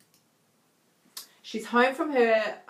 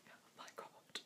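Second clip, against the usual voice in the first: whisper; 28 dB quieter than usual. Real, not acted. Laughing at her own mistake.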